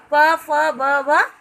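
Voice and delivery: each letter drawn out slowly and separately; slow letter recital. Shown as a demonstration. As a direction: sound the letters one by one, slowly. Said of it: "प वर्ग में देखिए". (वर्णों को धीरे-धीरे अलग करके बोलना)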